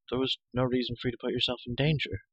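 ordinary delivery, nothing marked

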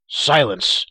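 loud, sudden order to be silent; ringing command. A narrator's reading. Gwydion stops Taran answering her, ringing command. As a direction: cut him off with a ringing cry. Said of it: "Gwydion's voice rang out".

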